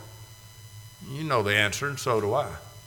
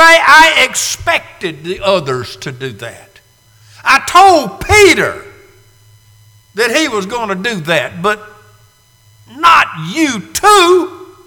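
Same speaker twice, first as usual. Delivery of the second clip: hurt plea; 6 semitones higher, 7 dB louder than usual. Acted. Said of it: "as if to say".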